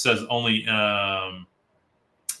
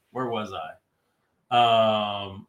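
ordinary talk

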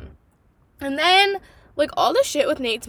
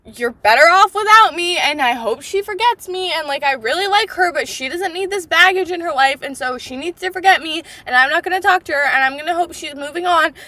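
self-deprecating Nate; 5 semitones higher, 5 dB louder than usual. Retold or imitated. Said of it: "fuck this self-deprecating".

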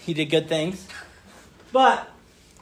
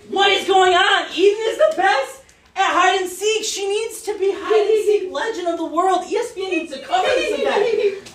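hammed-up searching dad; 12 semitones higher than usual. Dad pretending to search for his hiding daughter, hammed-up. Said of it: "I'm playing your role".

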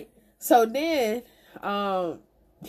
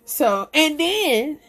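ordinary talk

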